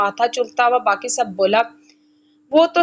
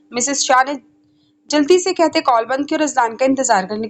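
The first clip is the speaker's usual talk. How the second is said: plain reading voice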